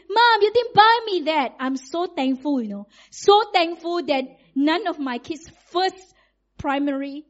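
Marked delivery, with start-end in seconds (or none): 0.1-1.6 put-on complaining child. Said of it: "They don't say".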